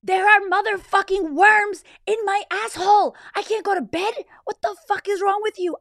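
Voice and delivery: high, full-force hysterical yelling; loud screaming rant. Retold or imitated. Retelling her meltdown at sleepy husband, loud hysterical screaming. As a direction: scream it at him in outrage. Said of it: "I'm about to get loud".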